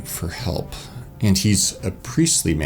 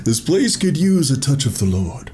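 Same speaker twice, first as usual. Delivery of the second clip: pious churchgoer; 7 semitones higher than usual. Acted. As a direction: say it like a devout believer. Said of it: "That's what we're gonna say".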